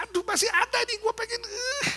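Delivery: high, strained, whiny exasperation; hammed-up high-pitched frustrated groan; mock pent-up frustration. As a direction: act barely holding in the rage, ending in a squeal. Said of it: "Teriak-teriak juga rasanya".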